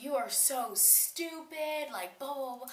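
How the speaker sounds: high, slow, drawn-out mock yell; stretched high-pitched scolding, not full volume; retold screaming. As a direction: imitate yourself ranting at a roommate. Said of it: "So I'm screaming at Megan".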